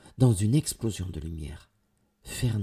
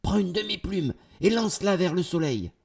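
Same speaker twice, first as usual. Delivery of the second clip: high, urgent instruction; raised, pressing voice; urgent command; 10 semitones higher, 4 dB louder than usual. A narrator's reading. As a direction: order it in the thick of a chase. Urgent order during the chase.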